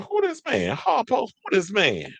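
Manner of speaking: high, incredulous hammed-up movie line; mock outraged demand. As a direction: ham up the shocked demand, voice climbing high. Quoting a famous movie line.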